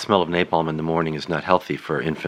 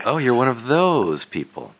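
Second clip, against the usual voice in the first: slow, higher, drawn-out teasing; playfully scandalized, stretching the words; mock disapproval; 4 semitones higher than usual. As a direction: tease with exaggerated, knowing judgement. Teasing reaction to his confession.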